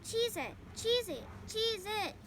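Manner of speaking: high, loud honking goose cry; gander alarm. A narrator's reading. Gander warns the barnyard, high goose-like alarm. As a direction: honk the warning like a goose.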